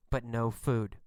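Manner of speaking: low, soft, deflated aside; understated letdown. A narrator's reading. Narrator reports the first fruitless wait, quietly deflated. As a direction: drop "but no food" low and quiet.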